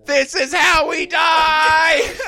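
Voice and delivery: very high, loud, drawn-out wail; hammed-up comic terror; mock panicked scream. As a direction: scream it slowly like certain doom. Acted panicked outburst in story.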